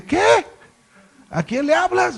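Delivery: high, startled "¿A quién le hablas?"; retold disbelief. Pastor stunned, asking God who He means, high and startled. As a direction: pitch it up in shock, then ask.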